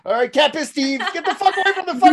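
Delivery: put-on shouting at the town drunk, laughing; high laughing yell to shoo him off. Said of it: "the town drunk".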